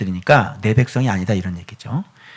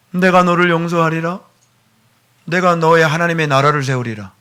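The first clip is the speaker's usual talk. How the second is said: ordinary talk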